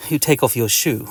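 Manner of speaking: plain reading voice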